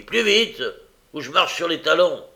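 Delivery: high, loud hurry-up; impatient threat. A narrator's reading. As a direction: threaten someone dawdling ahead of you. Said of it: "tout en jurant contre la lenteur".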